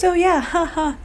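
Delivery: high, laughing mock voice of the clerk; imitating her laugh. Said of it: "And she laughed and she goes".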